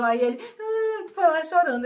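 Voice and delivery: high, loud mock crying of a small child; child's mock wail, hammed up. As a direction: wail like a kid who just fell. Imitating the child bursting into tears.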